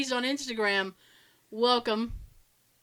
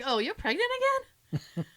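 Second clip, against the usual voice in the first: high-pitched retold astonishment; incredulous questioning; 8 semitones higher, 3 dB quieter than usual. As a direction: imitate baffled disbelief, pitched way up.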